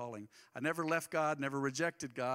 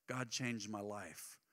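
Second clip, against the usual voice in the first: soft testimony; 5 semitones lower, 7 dB quieter, much slower than usual. Shown as a demonstration. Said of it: "Well, that's a start".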